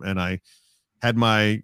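ordinary talk, nothing marked

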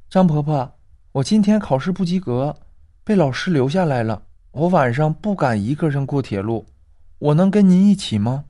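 high, timid schoolgirl voice; a scared little girl asking for company; young girl's voice. Acted. Girl begging Grandma Zhang to walk her home, high and timid.

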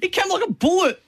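high-pitched excited exclamation; excited race reaction. Real, not acted. Punter reacts to a late runner in the race, excited and high.

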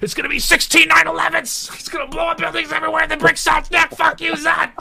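high, loud mock edgy-hero yell; hammed-up shouting. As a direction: yell it like an over-the-top blockbuster. Mocking an edgy Superman film.

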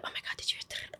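hushed gossip whisper; barely audible, voiceless whisper. Retold or imitated. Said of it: "whispering of".

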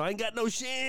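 Cotton voice. Retold or imitated. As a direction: bark it like a proud veteran. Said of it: "let everybody know".

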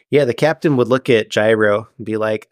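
normal talking voice

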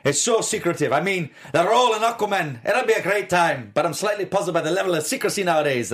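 amateur Scottish impression of an actor; Scottish accent. Cohost reads actor's quote for her partner in Scottish accent. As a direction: read the quote in a hammed-up Scottish burr. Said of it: "say it like he would say it".

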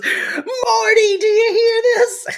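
high, shouted mock crying; mock wailing mother. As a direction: wail it like an outraged old mother. Retelling Sylvia crying to husband.

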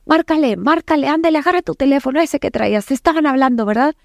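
high, hurried, bossy ordering; very aggressive. Retold or imitated. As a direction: imitate an aggressor pressing someone to dial. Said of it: "muy, muy agresiva".

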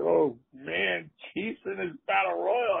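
high-pitched retold amazement; astonished. Rookie reacting as a veteran joins the battle royal, astonished.

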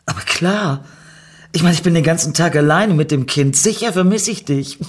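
high, overly bright and rushed; put-on cheer hiding guilt; forced smile. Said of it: "zwang sich zu einem Lächeln".

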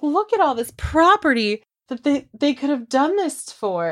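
high, showy mock of Emma pointing at land; lightly mocking her proud property tour. Podcaster mocks Emma showing reporter the land, high and showy.

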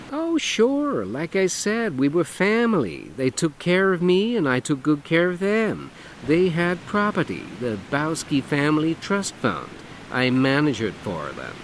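camp it up, lilting and showy; flamboyant man. Acted. Dougie voiced in story.

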